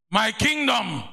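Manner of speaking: a preacher's ringing cry; proclaimed shout. Acted. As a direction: call it out to the church. Preacher proclaims his refrain.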